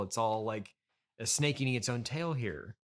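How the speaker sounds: usual speaking voice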